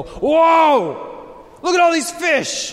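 high, loud thrilled shout; excited whoa. Retold or imitated. Pastor voicing Peter at the big catch, thrilled shout. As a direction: gasp out like a fisherman hitting the jackpot. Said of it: "Peter's reaction is gonna be ... to go".

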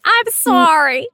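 sorry yelled high and slow; mock shouted apology. Joking host shouts a hammed-up sorry, high and loud. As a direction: holler the apology for laughs.

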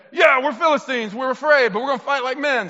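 high, loud group reply; mock rallied cheer. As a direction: play scared troops suddenly fired up. Troops answering the rally, acted.

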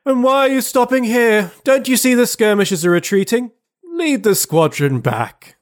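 high, sniffy colonel voice; stern colonel voice. Acted. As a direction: dismiss him like a troublesome fly. Colonel rebukes Denisov.

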